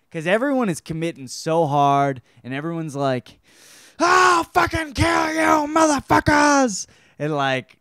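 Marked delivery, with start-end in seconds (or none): 4.0-6.9 high, slow, mock-screamed threat; screaming lines. Retelling zombie movie characters' lines, hammed-up screaming. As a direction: scream it like an overacting movie thug. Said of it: "just screaming lines to each other".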